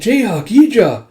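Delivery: high, loud name-calling; surprised calling out. Retold or imitated. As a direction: call out to friends who just burst in. Yona calls out to arriving friends.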